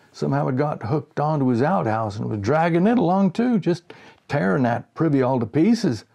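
put-on high, loud folksy old fisherman; old farmer voice. A narrator's reading. Old fisherman scolds the boy in the blizzard, high and folksy. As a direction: play a fussing old country man. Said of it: "he said".

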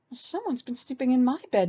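high mama bear wail. A narrator's reading. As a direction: wail it in dismay. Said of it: "Mama Bear wailed".

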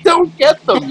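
very high mock complaint; imitating a grumble, pitched high; exasperated. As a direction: squeal it like you're fed up. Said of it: "sort of like".

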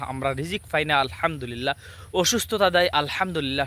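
ordinary delivery

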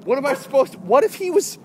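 high, loud mock-panicked scolding; mock alarm. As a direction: plead with a useless protector, hammed up. Scolds pet for not defending him.

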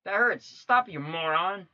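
high, loud complaint; annoyed yelp. Real, not acted. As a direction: snap at the game in pain. Reacting to game hit.

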